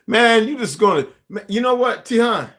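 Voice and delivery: high, loud, incredulous yelling; hammed-up high-pitched outburst; outraged movie character. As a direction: squawk it in disbelief, imitating the character. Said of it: "the other brother character who said".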